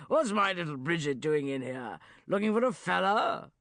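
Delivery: put-on loud hearty roar in an old man's voice. Uncle Geoffrey teasing Bridget in the pub, loud roar. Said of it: "he roared".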